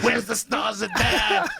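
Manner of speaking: high, loud, drawn-out fan holler; rowdy mock shout. Israeli fans looking for the comic, in a mock rowdy shout. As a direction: imitate a fan yelling for his idol.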